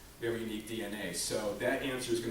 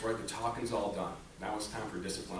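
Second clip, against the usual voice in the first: ordinary delivery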